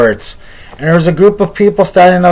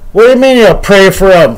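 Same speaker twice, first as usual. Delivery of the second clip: higher, mildly scornful retort; scoffing challenge; 4 semitones higher, 4 dB louder than usual. Retold or imitated. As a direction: challenge him as a scoffing bystander. Scoffing man challenges the elder.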